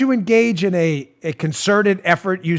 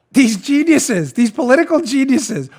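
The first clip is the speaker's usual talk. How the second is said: sarcastic geniuses; 5 semitones higher than usual. Acted. Sarcastic about never Trumpers.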